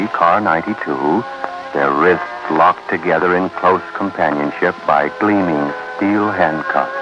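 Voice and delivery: normal talking voice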